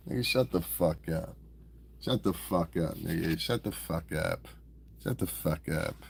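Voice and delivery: soft, low, repeated put-down; quiet dismissal. Acted. Streamer dismissing a tough-talking commenter, quietly. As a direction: drop your voice and shut them down softly. Deadpan dismissal after the mock voice.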